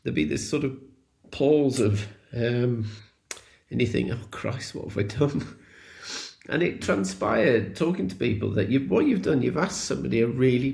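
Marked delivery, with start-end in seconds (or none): 4.1-5.6 breathless whisper of dread; panicked whispered thought. Retold or imitated. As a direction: whisper it like a sudden private panic. Panicked inner thought after awkward pause.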